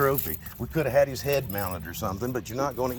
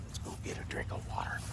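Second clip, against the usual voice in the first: whispered coaxing; 10 dB quieter than usual. Real, not acted. Coaxing dog softly.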